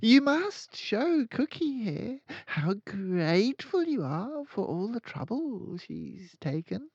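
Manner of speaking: high, slow, sly bullying headmistress; sly bully goading the boy to thank the cook; crafty Trunchbull. A narrator's reading. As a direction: purr it out with a crafty grin, a bully toying with him. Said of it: "with a crafty grin".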